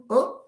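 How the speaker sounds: high, relaxed open Oh, mouth slightly open; relaxed vowel demo. Shown as a demonstration. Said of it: "افتح شوية فمك وقول".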